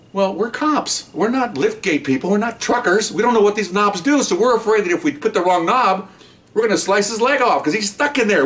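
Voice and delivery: pitched well up, rushed and worried; panicky protest. Retold or imitated. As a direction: plead that you're cops, not truckers.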